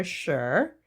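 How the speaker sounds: ordinary delivery, nothing marked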